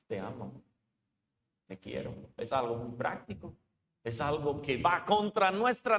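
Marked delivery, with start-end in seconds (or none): 0.1-2.1 quiet echo. Retold or imitated. Preacher repeats the empty love words, low and soft. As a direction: lower and much softer, almost to oneself. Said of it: "estoy gritando con mis acciones".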